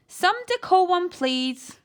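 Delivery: loud, high posh gentleman; posh exclaiming. A narrator's reading. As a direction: plead for order grandly. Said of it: "he exclaimed".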